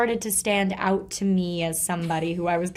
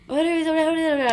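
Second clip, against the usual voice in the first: rapid run-together mock gibberish, pitched high; high mock mumble of an accented boyfriend; imitating boyfriend's mumbling; 8 semitones higher, 3 dB louder than usual. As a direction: babble it like incomprehensible mumbling. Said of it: "mumbling to yourself".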